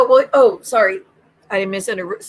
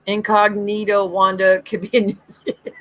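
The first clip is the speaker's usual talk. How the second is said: normal talking voice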